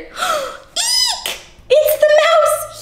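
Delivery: high squeaky pretend scare; mock fright. Teacher playing the scared mouse for children, high mock squeal.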